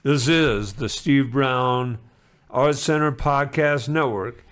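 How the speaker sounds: slow, low announcer read; measured station-ID delivery; podcast ident. Real, not acted. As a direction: announce the show slowly and low. Host reads the podcast ident.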